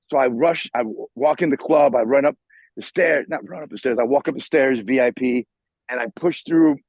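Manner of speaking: usual speaking voice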